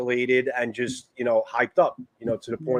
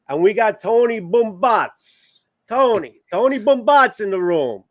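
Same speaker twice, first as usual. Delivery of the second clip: New York accent; 9 semitones higher, 6 dB louder, slower than usual. Real, not acted. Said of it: "now that you said it with your New York accent".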